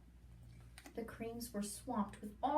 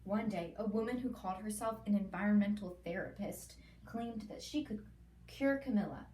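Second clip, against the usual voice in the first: ordinary delivery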